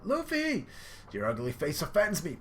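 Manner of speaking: high mock shout at Luffy; angry Sanji. Sanji yelling at Luffy while kicking him. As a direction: bark the insult, hammed up. Voicing Sanji mid-fight.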